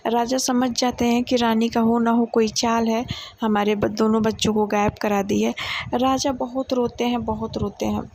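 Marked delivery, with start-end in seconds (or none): none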